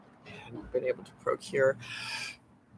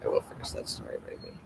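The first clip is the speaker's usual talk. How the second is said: ordinary delivery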